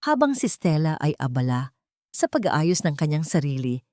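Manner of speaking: ordinary delivery, nothing marked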